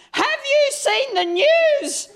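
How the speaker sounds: high, slow, screaming mock mum; mum's all-caps text. Mum's caps-lock text screaming the news at her son, high slow mock shriek. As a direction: read it like a text in capitals shrieking at you. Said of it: "the text message screams at me".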